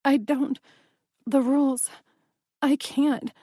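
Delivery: a desperate, tearful stammer; broken tearful plea. Acted. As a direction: stumble over the words, near crying.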